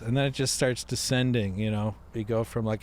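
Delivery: normal talking voice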